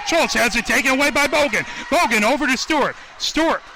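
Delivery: rising, fast burst of play-by-play; excited call. Real, not acted. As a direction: call the steal fast and high. Turnover called live.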